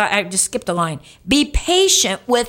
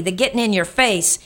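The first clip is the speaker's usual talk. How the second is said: ordinary talk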